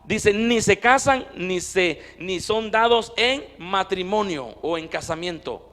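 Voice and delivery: loud, fervent pulpit delivery; full-force preaching. Acted. Pastor preaching loudly to his congregation, fervent. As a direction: preach it out to the whole church.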